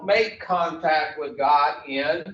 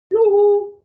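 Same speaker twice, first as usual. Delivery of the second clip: calling out; 8 dB louder than usual. Real, not acted. Calling for the room's attention.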